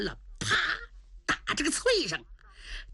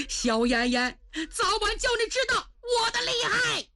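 high shouted threat; defiant threat; 12 semitones higher than usual. A narrator's reading. The beaten prince threatens the empress, shouting high. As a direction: hurl a threat back. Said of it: "太平王不服".

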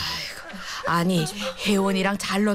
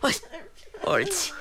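ordinary talk, nothing marked

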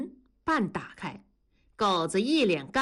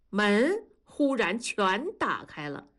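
ordinary delivery

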